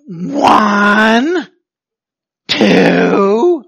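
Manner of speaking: loud, drawn-out mock count to a child; counting parent. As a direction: count slowly like a threatening parent.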